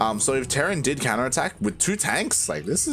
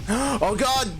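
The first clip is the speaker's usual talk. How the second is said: surprised gasp; 11 semitones higher than usual. Real, not acted. Gasp at a widow mine hit.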